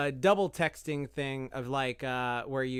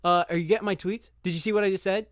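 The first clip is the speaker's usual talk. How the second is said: mock needy pleading; 5 semitones higher, 5 dB louder than usual. Mocking needy double texter.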